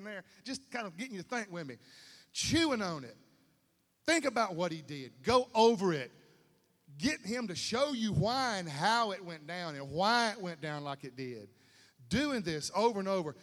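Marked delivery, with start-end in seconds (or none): 2.3-13.4 loud, rising preacher's exhortation; loud, impassioned pulpit voice; fervent preaching. Acted. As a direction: push the church to keep at it. Preaching at full force.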